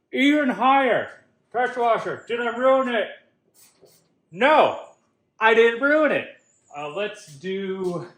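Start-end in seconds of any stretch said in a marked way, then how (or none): 0.1-1.1 excited shout. Real, not acted. Gleeful boast after success. 1.5-6.3 loud announcer. Acted. Loud game-show style verdict.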